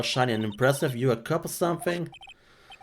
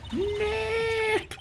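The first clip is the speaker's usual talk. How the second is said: high drawn-out call. Acted. Calls the name at the camera.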